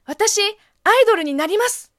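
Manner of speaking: put-on high, loud bright idol-girl line; loud, high anime teen girl voice; teen girl role. Shown as a demonstration. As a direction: announce it like a teenage heroine. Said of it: "十代の女の子役をこうやるとすると".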